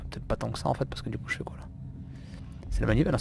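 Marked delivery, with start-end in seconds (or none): 0.1-1.7 whispering while hiding. Real, not acted.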